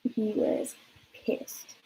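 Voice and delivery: hushed, very slow whispered aside; soft slow whisper. Real, not acted. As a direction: breathe it out slowly, almost to yourself. Aside after the hang-up.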